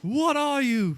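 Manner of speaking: loud high demanding chef; barked mock interrogation; mock Ramsay bark. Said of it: "lamb sauce".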